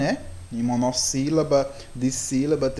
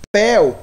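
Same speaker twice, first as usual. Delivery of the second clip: "pel" singled out and said loud; stressed syllable; 4 semitones higher, 8 dB louder than usual. Shown as a demonstration. Teacher pointing out the rising syllable to pupils, "pel" said with force. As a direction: hit "pel" hard as the strong syllable. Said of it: "a intensidade dele é mais alta".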